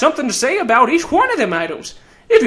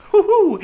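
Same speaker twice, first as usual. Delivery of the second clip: gleeful hoot; 8 semitones higher than usual. Acted. Gleeful chuckle.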